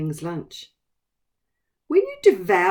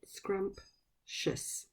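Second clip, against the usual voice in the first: whispered syllables; 13 dB quieter than usual. Shown as a demonstration. Teacher counting syllables with the class, whispered. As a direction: whisper "Scrumptious" in two clear parts, scrump and tious. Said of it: "How many syllables".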